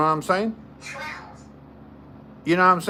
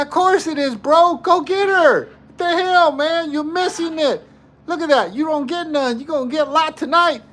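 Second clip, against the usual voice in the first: much higher, pushy wingman hype; hyped egging on; 9 semitones higher than usual. Retold or imitated. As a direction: egg your buddy on, pitch way up. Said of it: "I said".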